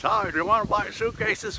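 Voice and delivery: hammed-up paralyzed salesman; paralyzed-face sales pitch. Imitating himself pitching suitcases with a frozen face, slurred. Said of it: "and I'm just".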